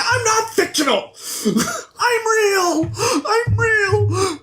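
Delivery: high, hammed-up hysterical wail; freaking out. Storyteller acting a character learning he's fictional, hammed-up panic. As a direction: wail it high, gasping between phrases. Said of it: "the fictional character is freaking out".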